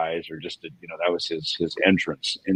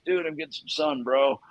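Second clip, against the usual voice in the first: laid-back dude; 8 semitones higher, 3 dB quieter than usual. Retold or imitated. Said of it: "He goes".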